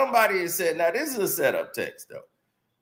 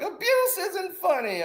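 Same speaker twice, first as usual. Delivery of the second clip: much higher prissy-sounding mock critic; mock scolding critic; 12 semitones higher, 4 dB quieter than usual. Mocking commenters who object, high mock scolding. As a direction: parody the offended commenter, pitched up. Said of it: "there's like".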